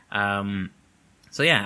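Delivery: ordinary delivery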